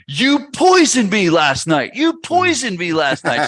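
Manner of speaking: loud, slow, high accusing yell; mock furious wife shouting the accusation; retold yelling. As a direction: yell it at him like he poisoned you. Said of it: "she starts yelling at me".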